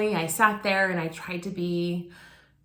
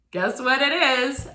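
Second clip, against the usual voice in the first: usual speaking voice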